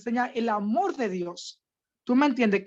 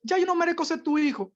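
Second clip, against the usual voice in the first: higher, pleading son; prodigal son pleading; 6 semitones higher than usual. Acted. Prodigal son begging his father to take him back, pleading higher voice. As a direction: beg like a lost son coming home. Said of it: "y dijo".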